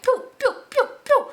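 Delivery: high, loud "pew pew" zapping sounds; mock rushing noise. Describing rushing through healing: high, loud mock pew-pew. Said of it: "you were going, like".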